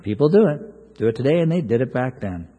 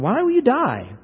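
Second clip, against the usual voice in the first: pleading prophet; 5 semitones higher than usual. Retold or imitated. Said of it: "he says to him here".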